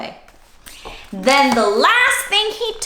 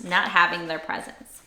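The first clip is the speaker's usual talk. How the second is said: ordinary delivery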